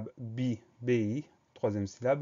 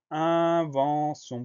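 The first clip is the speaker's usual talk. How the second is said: very slow, higher, syllable by syllable; drawn-out dictation word; 4 semitones higher, much slower than usual. Shown as a demonstration. Teacher presenting the next word to pupils, slowly drawn out. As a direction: stretch the word out for learners to write.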